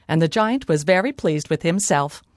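plain reading voice

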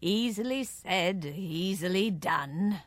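slow, measured fairy king; commanding fairy king. A narrator's reading. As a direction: grant it with slow, kingly assurance. Fairy king grants request.

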